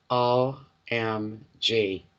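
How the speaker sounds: very slow, letter by letter; deadpan retold dismay, each letter separate; drawn-out disbelief. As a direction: spell it out with long pauses. Mother reacting to laughing son.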